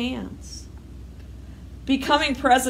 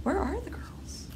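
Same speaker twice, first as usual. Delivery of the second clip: stunned whisper; 8 semitones higher, 11 dB quieter than usual. Acted. Grace repeats, whispering.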